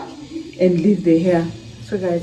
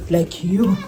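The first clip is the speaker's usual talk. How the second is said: ordinary talk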